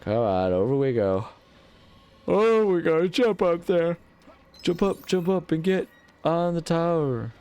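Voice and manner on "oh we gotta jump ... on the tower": high cartoon voice. Excited cartoon voice while jumping.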